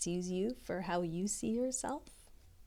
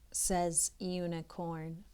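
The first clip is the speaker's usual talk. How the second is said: plain reading voice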